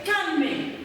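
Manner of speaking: normal talking voice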